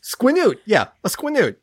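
squinoot said firmly, falling; confident. Real, not acted. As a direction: own the word squinoot. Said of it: "I stand by that".

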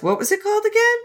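high playful voice. Retold or imitated. Quoted dialogue voices.

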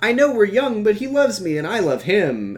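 high, mock lovesick pleading; imitated teenage begging. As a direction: plead like a lovestruck girl, easing down at the end. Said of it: "Amy's begging to marry Ben".

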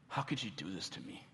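low, soft, wounded question; subdued reproach. Acted. Sufferer looking to God in hard times, subdued reproach. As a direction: ask it quietly, as a hurt complaint.

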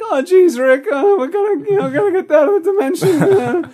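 very high, cracking, panicky cartoon voice; Morty. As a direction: fret loudly in a squeaky cracked pitch. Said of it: "everyone always thinks it's Morty from Rick and Morty".

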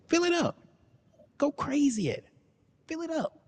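very high, silly falsetto; comic falsetto; squeaky high voice. Pretend. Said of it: "Why your voice keep changing?".

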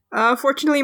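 usual speaking voice

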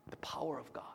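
hushed whisper of awe. Real, not acted. Preacher to congregation on God's power, whispered in awe. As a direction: breathe the words in awe.

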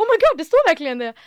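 fast, high and loud realisation; excited rush. Retold or imitated. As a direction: it is finally sinking in. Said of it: "Jag bara". (snabbt och upphetsat, högt och ljust)

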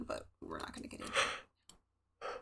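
low whispered throwaway; whispered aside. Real, not acted. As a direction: drop to a whisper, dismissive. Whispered aside dropping topic.

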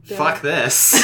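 hissing reptile growl; loud hissing lizard snarl. Acted. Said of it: "but in a lizard voice".

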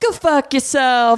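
imitating a player cursing at the ref; mock player insult. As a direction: fire off the player's curse, half-joking. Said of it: "is what she said".

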